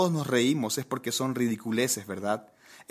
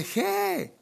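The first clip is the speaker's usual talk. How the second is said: very high mock yelp; teasing imitation of a crash scream; imitated cry. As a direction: squeal the syllable, no extra volume. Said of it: "de lo que gritaste".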